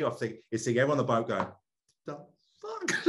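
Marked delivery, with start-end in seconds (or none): 2.1-3.1 "fuck" shoots up very high in shocked disbelief; stunned disbelief. Retold or imitated. People on the boat watching him jump in, shocked high squeak. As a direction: squeak it out like the whole boat can't believe it.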